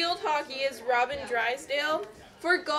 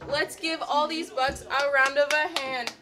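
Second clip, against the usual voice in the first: ordinary delivery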